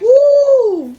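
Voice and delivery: high, loud, drawn-out woo; whoop. Real, not acted. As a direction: let out one long piercing whoop. Exclaims over number of comments.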